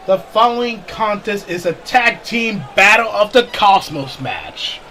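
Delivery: booming wrestling announcer voice; ring announcer. Acted. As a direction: announce it to the arena. Announces match rules.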